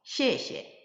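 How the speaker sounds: "시에시에" with a falling first syllable and a short, light second. Shown as a demonstration. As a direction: drop "시에" from high, then tap the second lightly. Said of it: "경성 발음으로 시에시에". (뒤 시에를 짧고 가볍게 경성으로)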